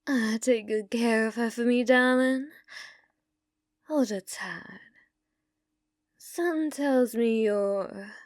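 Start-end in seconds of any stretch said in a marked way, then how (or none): none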